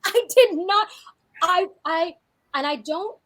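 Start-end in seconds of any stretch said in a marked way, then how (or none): none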